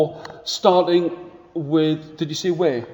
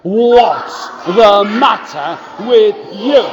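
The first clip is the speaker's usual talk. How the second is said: put-on slow, louder mean-boy shout; 3 semitones higher, 6 dB louder, slower than usual. Shown as a demonstration. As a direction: call it out for the class to copy. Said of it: "Can you say that with me?".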